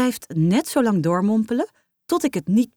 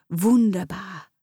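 Duits gemompeld; slower than usual. A narrator's reading. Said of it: "mompelt ze".

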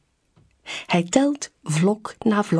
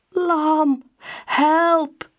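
calling for help; 10 semitones higher than usual. A narrator's reading. Hurt pup calls for help.

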